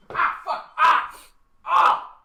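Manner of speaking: sharp real yelps of pain after stubbing a toe; pained yell. Real, not acted. Stubs toe mid-stream.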